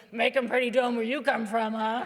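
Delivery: loud, put-on drawling mock; mocking inner thought. Waitress thinking about the snobby customer, mocking and loud. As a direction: tease the city snob. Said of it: "she's thinking".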